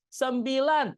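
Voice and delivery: high, loud Sembilan in three beats as sem-bi-lan; counting aloud. Shown as a demonstration. Teacher counting syllables in Indonesian for parents. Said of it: "tiga suku kata".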